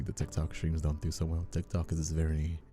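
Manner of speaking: ordinary talk, nothing marked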